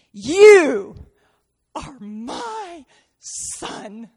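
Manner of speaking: loud You, then a very slow, hushed are my son; the Father speaking tenderly to the Son; tender whisper. Acted. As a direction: let the love soften into a hush.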